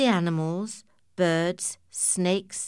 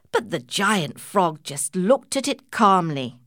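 usual speaking voice

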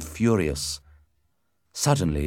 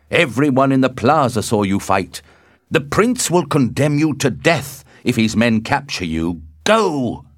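loud, raised-pitch warning; urgent cry; 7 semitones higher, 7 dB louder than usual. A narrator's reading. Benvolio urges Romeo to escape, crying out loudly. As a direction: warn him urgently at full voice. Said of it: "cried Benvolio".

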